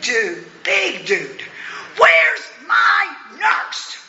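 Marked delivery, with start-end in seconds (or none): 2.0-4.0 low, slow shouting demand; retold yell. Big dude yelling for his nurse, loud shout. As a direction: bellow it like a big angry patient. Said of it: "this guy's yelling".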